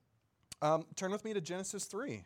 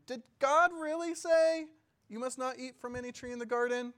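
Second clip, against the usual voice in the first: doubting serpent; 8 semitones higher, 6 dB louder than usual. Acted. Serpent questioning the woman about God's command, high and accusing. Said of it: "He said to the woman".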